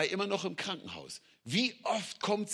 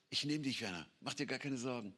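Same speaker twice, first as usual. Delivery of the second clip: gentle reassurance; 4 semitones lower, 10 dB quieter, faster than usual. Retold or imitated. God reassuring Werner.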